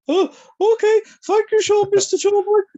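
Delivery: very high, loud mock falsetto; comically high-pitched starstruck thanks; starstruck squeak. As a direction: squeal your thanks like an overawed fan. Acts out his starstruck reply.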